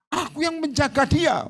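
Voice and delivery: a spirit claiming its charge; demon voice. Retold or imitated. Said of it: "akhirnya ngomong".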